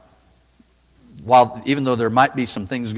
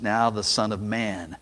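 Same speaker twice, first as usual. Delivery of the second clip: ordinary talk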